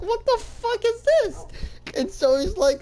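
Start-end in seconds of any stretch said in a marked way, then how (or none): 0.0-1.4 very high squeaky outcry; mock shocked disbelief. Prisoner seeing his ghost roommate, squeaky shock. Reacting to poop-covered ghost.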